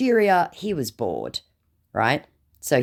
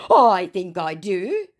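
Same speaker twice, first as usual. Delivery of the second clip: drawn out, deliberate; very slowly; 6 semitones higher, 3 dB louder than usual. Retold or imitated. Scammer answering her slowly as he gets triggered. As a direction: stretch each word. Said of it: "said our scammer very slowly".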